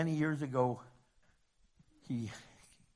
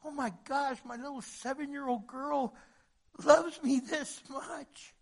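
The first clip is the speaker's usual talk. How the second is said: awed realization; 10 semitones higher than usual. Retold or imitated. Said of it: "made him realize".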